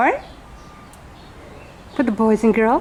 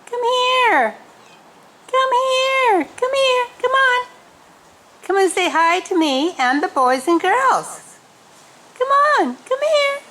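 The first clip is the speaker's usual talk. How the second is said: calling an animal; 10 semitones higher than usual. Real, not acted. Calling animal over, high.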